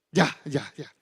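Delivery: low mumbled ya ya ya; grudging agreement; muttered giving in. Retold or imitated. Husband gives in to his wife, low and mumbled. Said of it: "y Cindy me dice".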